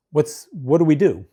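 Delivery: usual speaking voice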